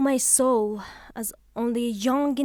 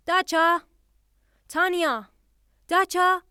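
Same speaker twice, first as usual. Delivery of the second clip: calling out; 5 semitones higher than usual. Acted. Widow calls for maids.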